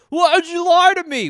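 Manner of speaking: high, loud wounded wail; betrayed man-child. Acted. Grown son accuses his parents, betrayed. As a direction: cry out like a cheated kid.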